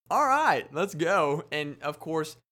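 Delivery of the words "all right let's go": much higher and louder, thrilled; hyped. Retold or imitated. Fan thrilled to get a photo, hyped cheer. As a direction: cheer it like you won. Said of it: "I was like".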